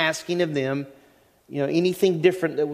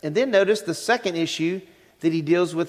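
usual speaking voice

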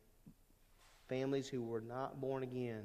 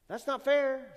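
high, loud whiny protest; mock complaint; 11 semitones higher, 8 dB louder than usual. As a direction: whine it loud, mocking the complainer. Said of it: "we looked at Job".

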